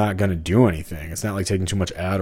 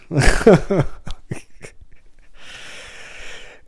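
breathy high laugh; 10 semitones higher than usual. Real, not acted. Laughing after a Seinfeld quote.

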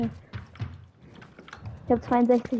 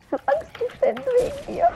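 mock fear; 11 semitones higher than usual.

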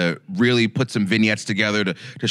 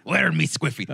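hammed-up pirate, pitched up with rolling arrs; 5 semitones higher than usual. Co-host showing off his Blackbeard, hammed-up pirate. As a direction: play a comic pirate through laughter. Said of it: "[name] also does a very good pirate".

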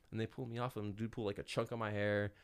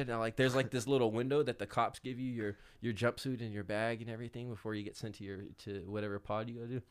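normal talking voice

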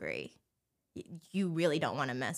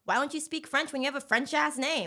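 mocking; 8 semitones higher than usual. French Canadians teasing her for no French, mocking high voice. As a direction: needle her in a higher, put-on voice. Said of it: "they'd make fun of me".

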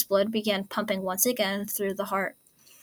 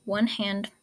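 plain reading voice

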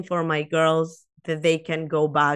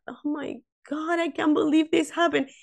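shocked; 10 semitones higher than usual. Retold or imitated. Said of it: "and I was like".

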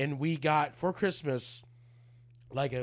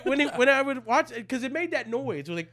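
excited retelling; 9 semitones higher, 6 dB louder than usual. Real, not acted. Excited retelling of Mario noise.

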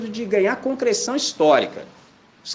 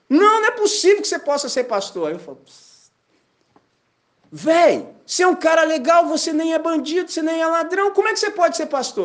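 much higher, disbelieving, arguing; incredulous protest; 12 semitones higher, 3 dB louder than usual. Retold or imitated. Stranger insisting a nice guy cannot be a pastor, incredulous. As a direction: refuse to believe it, pitched up.

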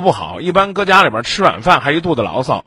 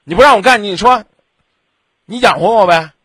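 voicing the wife; 5 semitones higher, 6 dB louder than usual. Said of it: "你媳妇儿一句话就噎死你了".

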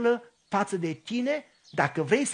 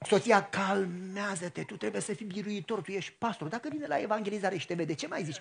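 calming inner voice; 8 dB quieter than usual. Retold or imitated.

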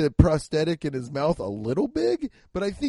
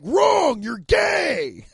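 loud, high comic yell; mock shouted verdict; 12 semitones higher, 5 dB louder, much slower than usual. Everybody answering Freddie's confession, mock shouting. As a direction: shout it down like a jeering crowd. Mimicking everybody's blunt reply.

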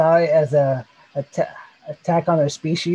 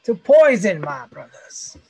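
movie-villain quote; 4 semitones higher, 5 dB louder than usual. Retold or imitated. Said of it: "or some shit like that".

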